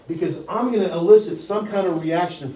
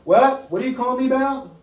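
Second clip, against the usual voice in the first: high, raised-voice puzzled demand; confused phone callee; 8 semitones higher, 5 dB louder than usual. Acted. Callee to an unclear cold caller, confused and high-pitched. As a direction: act someone who can't make out the caller. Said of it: "have they said this to you".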